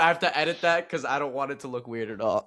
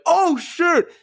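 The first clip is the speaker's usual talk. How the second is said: much higher, louder startled exclamation; mock surprised outburst; 6 dB louder than usual. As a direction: yelp it like something just happened on screen. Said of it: "I'd be like".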